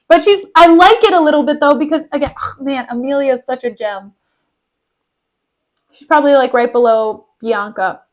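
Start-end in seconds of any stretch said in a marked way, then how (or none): none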